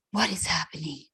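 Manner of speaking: soft, concerned whisper; sweet nurse. Retold or imitated. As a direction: whisper it gently, imitating a kind nurse. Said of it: "she's real sweet".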